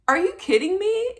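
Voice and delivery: high, rising disbelief; stunned question with a lift at the end; disbelieving question. Retold or imitated. Patient to her doctor on pregnancy news, high disbelief.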